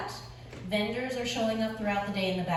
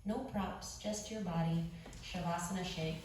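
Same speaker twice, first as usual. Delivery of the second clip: guided meditation; 3 semitones lower, 10 dB quieter than usual. Acted. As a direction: ease them into rest, softly. Leads quiet guided meditation.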